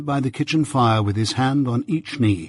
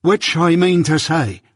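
higher, loud protest from the blacksmith; indignant outcry; 6 semitones higher, 4 dB louder than usual. A narrator's reading. Joe protesting to Jaggers about compensation, indignant outcry. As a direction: burst out, ready to fight. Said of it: "cried Joe".

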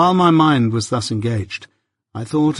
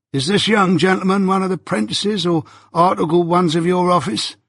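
teasing turnkey; 7 semitones higher than usual. A narrator's reading. Turnkey teases Wemmick.

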